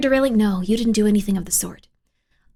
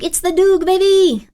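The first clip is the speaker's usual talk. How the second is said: hype catchphrase; 6 semitones higher, 3 dB louder than usual. Acted.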